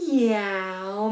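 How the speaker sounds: long drawn-out cat meow. Shown as a demonstration. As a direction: meow like a cat while massaging. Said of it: "meow like a cat".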